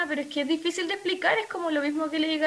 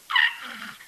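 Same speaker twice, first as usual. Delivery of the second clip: very high shriek at a moth; high mock scream. Girl at one in the morning shrieking for her dad. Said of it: "y yo".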